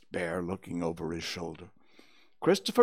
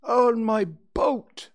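slow, high, loud proud announcement; stretched-out proud declaration; proudly; 11 dB louder, much slower than usual. A narrator's reading. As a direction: boast as a pleased little bear. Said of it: "said Pooh proudly".

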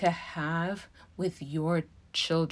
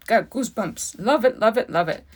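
moved, breathless gush; getting emotional; 4 semitones higher, 5 dB louder than usual. Real, not acted. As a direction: overcome with real feeling, bursting out. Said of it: "I'm just getting emotional".

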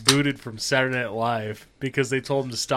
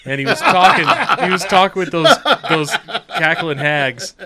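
laughing; 3 semitones higher, 5 dB louder than usual. Real, not acted. Laughing while telling.

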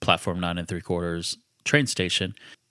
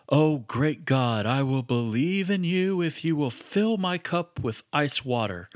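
slow, lofty, much higher mock-prayer voice, mocking believers; mock grand prayer; 7 semitones higher, slower than usual. Atheist friend mocks prayer to God.

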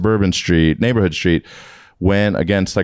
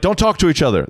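high-pitched mock command; retold urgent order, pitched up; imitated cop's order; 9 semitones higher, faster than usual. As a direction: lightly act out a cop cutting people off. Retold cops ordering them apart.

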